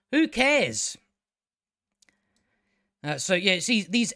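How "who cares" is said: high, exclaimed dismissal; exasperated, high-pitched outburst; emphatic dismissal. Real, not acted. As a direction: throw it out with voice leaping up.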